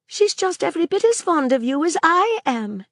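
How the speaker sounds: put-on a child asking anxiously; Maisie, young girl. A narrator's reading. Girl asking Sir Claude, lightly voiced child.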